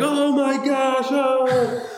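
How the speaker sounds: imitating high, slow, stunned gasp; mock shocked team. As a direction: draw it out high in disbelief. Team shocked after Tom wins.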